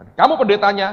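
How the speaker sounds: high, forceful shout; booming acted rebuke from above; sudden loud command. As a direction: call him out with authority. God's sharp command, acted out.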